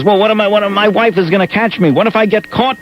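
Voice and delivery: retold worry, starting high and easing down; mock afraid. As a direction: act out a fretting philanderer, lightly. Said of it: "Robert Morse is afraid".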